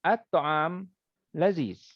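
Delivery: At-ta'am lifted and held, short pause, laziz falling; At-ta'am rising, then laziz set apart; pause after the subject. Shown as a demonstration. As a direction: break briefly between At-ta'am and laziz. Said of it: "dia intonasi dia, dia ada putus kejap".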